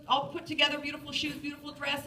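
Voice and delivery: normal talking voice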